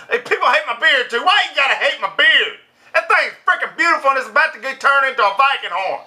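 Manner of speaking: high-pitched comic protest; mock indignation. Vlogger defending his beard from haters, in high mock indignation. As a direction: ride it up high, defending your pride.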